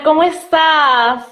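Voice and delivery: choppy, broken-up call audio; words breaking up on a bad line; bad connection. Real, not acted. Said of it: "Se escucha un poco entrecortado".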